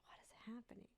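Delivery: breathless whispered disbelief; stunned whisper. Retold or imitated. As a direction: barely voice it, stunned. Stunned at child's words in dream.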